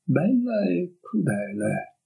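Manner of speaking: slow, faraway echo of "Bella e crudele"; slow dreamy. A narrator's reading. The girl echoing it to herself, slow and dreamy. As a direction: drift through "Bella e crudele" slowly, lost in thought. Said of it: "ripeté lei in tono sognante".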